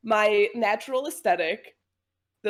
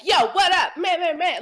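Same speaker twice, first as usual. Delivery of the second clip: loud, higher-pitched put-on street greeting; cringy mock slang; 7 semitones higher, 5 dB louder than usual. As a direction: badly fake a hip greeting, louder and higher. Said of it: "that was cringy".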